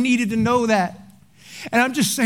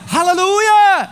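mock hallelujah; 9 semitones higher, 5 dB louder, slower than usual. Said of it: "And I was like".

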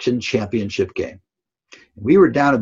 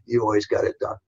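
softer, gentle echo of the first take; soft repeat; 4 dB quieter, faster than usual. Retold or imitated. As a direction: repeat it quietly, meaning it. Coach repeats reassurance.

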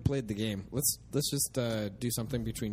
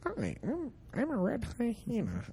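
silly high voice; 13 semitones higher than usual. Pretend. Introducing himself as the hyena.

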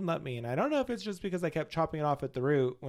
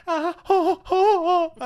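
high, loud mock "ow" cries; hammed-up yelping under a hair tug; retold pained yelps; 9 dB louder, much slower than usual. Comic retelling of painful hair rolling, mock yelps.

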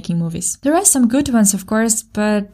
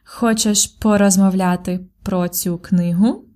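"Хочеш порозмовляти про цю книгу?" said slowly, rising at the end; slow rising question; slower than usual. Shown as a demonstration. Teacher offers an alternative verb for learners, slow with a rising tune. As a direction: say it slowly and clearly, lifting the final word. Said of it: "you could also use порозмовляти".

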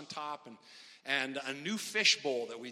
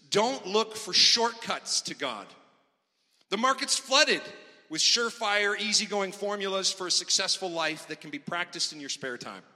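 preached scripture reading; 4 semitones higher, 6 dB louder than usual. Acted. Reads the Message verses.

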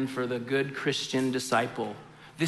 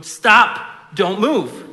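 firm, louder parent warning; warning a small child; 5 semitones higher, 8 dB louder, much slower than usual. Retold or imitated. Parent in a parking lot telling toddler don't move. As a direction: hold the kid in place with your voice. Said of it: "Like, I'd go".

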